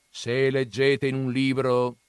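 gruff grandfather. Acted. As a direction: scoff at the books' dreamers. (nonno burbero accennato, tono sprezzante)

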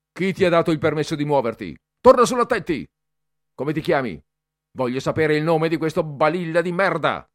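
loud, high, fast bullying yell; furious shout. A narrator's reading. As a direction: scream at the boy. Cadet bullying boy. (Bertoli urla furioso contro il ragazzo, forte e alto)

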